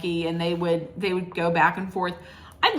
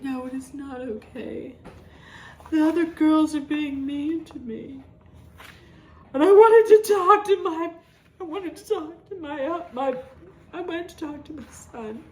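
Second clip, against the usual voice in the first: mock whining; 7 semitones higher than usual. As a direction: whine it like a pitiful sulking woman. Mocking Meredith's whining complaint.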